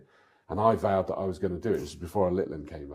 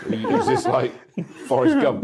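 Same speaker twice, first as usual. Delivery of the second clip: laughing; 8 dB louder than usual. Real, not acted. Joking about running.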